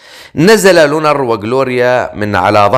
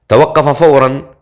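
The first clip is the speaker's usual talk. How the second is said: ordinary delivery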